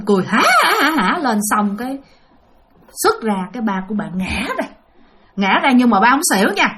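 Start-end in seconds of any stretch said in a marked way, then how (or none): 0.3-0.9 imitated ghostly "ha ha"; higher, staged laughing; possessed laugh. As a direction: laugh out a mock spirit cackle. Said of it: "ba của bạn cười lên".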